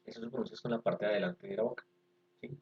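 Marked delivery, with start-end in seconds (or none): none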